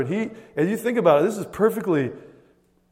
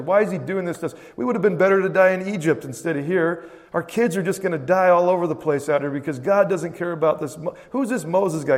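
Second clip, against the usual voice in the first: higher-pitched mock complaining; grumbling Israelites; 3 semitones higher than usual. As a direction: imitate a crowd griping in protest.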